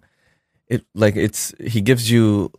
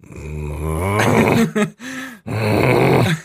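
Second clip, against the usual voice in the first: mimicked animal rumble; imitated bear growl. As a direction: growl like a bear starting to roar. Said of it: "not supposed to roar ever".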